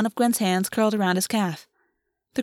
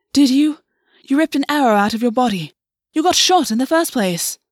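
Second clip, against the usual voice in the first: loud, raised-pitch accusation; angry challenge; 5 semitones higher, 5 dB louder than usual. Acted. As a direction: throw the reproach in their face. August angrily challenges Gwen.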